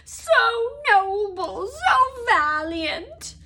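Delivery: high, loud, long-drawn moan; miserable ecstasy. A narrator's reading. Dobby worshipping brave Harry, moaning ecstatically. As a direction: swoon over each word in a high elf wail. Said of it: "moaned Dobby in a kind of miserable ecstasy".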